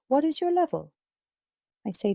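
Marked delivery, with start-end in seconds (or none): 0.1-0.9 mildly surprised question, higher pitch. A narrator's reading. Teacher surprised by the struggling student, mildly surprised. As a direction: ask it high, with a touch of surprise. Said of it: "The teacher looks surprised".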